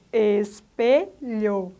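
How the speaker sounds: ESPELHOU drawn out in three separate beats; separação silábica. Shown as a demonstration. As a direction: say espelhou very slowly, syllable by syllable. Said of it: "três conjuntos sonoros". (espelhou, bem devagar, sílaba por sílaba)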